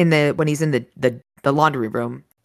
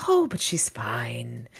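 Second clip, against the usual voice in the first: slow, hushed villain whisper; 4 semitones lower, 5 dB quieter, slower than usual. Acted. Villain taunting the captive girl's rescuer in a whisper. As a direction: breathe it out low and slow.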